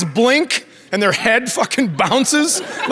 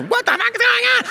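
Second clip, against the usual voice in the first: much higher squeal of confusion; squeaky confused reaction. Retold or imitated. Mimicking daughter reacting to TikTok, squeaky. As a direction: squeak it out baffled.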